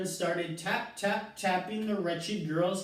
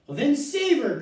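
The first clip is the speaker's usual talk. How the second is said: high, loud desperate plea; crying out; 8 semitones higher, 6 dB louder than usual. A narrator's reading. Mother crying out to her husband, high and loud. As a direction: push her husband to act. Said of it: "cried Missus Salt".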